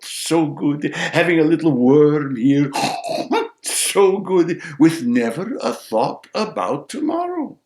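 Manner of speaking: high, loud carefree sparrow tale; animated folk-tale narration. A narrator's reading. Animated telling in grandfather's voice.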